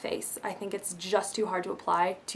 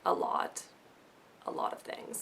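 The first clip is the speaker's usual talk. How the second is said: whispered confession; 10 dB quieter than usual. Real, not acted. Confessing how much she bought.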